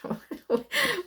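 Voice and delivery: a sudden high squeaky laugh that falls away; weird high laugh. Real, not acted. Tarot reader to viewers, weird high falling laugh. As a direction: let a strange high giggle slip out and drop. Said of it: "I just laughed that weird laugh".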